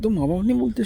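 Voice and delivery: ordinary talk, nothing marked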